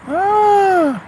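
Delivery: sharp pained cry, high pitched; pained high gasp. Real, not acted. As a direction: yelp from a sudden twinge. Sharp pain from teeth.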